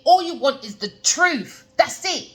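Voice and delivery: ordinary talk, nothing marked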